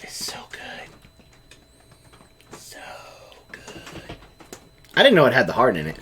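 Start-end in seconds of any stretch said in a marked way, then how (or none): 0.0-4.2 whispering. Real, not acted. Savoring the taste, whispered.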